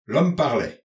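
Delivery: usual speaking voice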